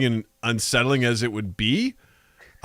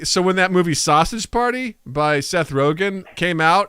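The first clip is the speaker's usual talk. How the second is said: ordinary talk, nothing marked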